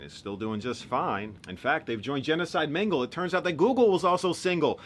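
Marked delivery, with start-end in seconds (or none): 1.5-4.8 loud, high, building proclamation; hammed-up loud reveal; mock announcement. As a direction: proclaim it loudly, rising in pitch. Mock announcement within the poem.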